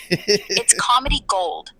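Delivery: ordinary talk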